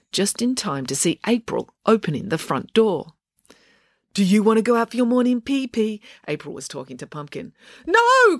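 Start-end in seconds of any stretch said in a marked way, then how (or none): 4.1-6.0 high cooing to the dog; April baby talk. A narrator's reading. April asks her dog about his walk, cooing. 7.9-8.4 high, loud cry of no. A narrator's reading. Loretta cries out as April opens the door, loud. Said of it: "cried Loretta".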